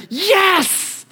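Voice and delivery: put-on high, joyful child's shout; excited yes. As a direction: burst out like a thrilled kid. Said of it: "He's like".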